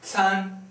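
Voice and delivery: can hoch und gleichbleibend; can hoch gehalten; first tone. Shown as a demonstration. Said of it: "First tone".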